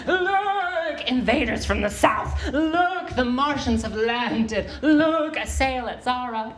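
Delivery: high, ringing wizard cries of warning; hammed-up wizard proclaiming doom. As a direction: call out like a wizard warning the village. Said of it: "a wizard warning a village of peril".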